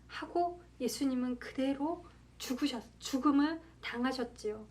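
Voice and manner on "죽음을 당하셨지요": usual speaking voice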